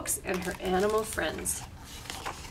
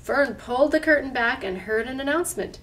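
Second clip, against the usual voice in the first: plain reading voice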